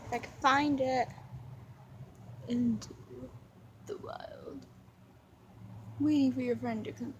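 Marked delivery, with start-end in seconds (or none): 2.5-4.7 hushed, breathy "Into the wild"; dramatic whisper. Pretend. Filming the dog, whispering a dramatic title. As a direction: whisper it like a trailer.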